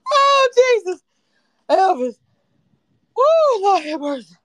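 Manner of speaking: loud, high exclaiming; overcome with hilarity. Real, not acted. Host recovering from her [name] joke, loud exclaiming. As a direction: cry out, worn out by your own joke.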